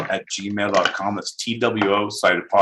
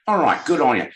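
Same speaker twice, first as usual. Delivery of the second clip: put-on higher Australian accent, rising to a squeak; "me headphones" in a higher, comic Aussie voice; mock Australian; 11 semitones higher than usual. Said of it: "It is Australia".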